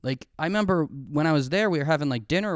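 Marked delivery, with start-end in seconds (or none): none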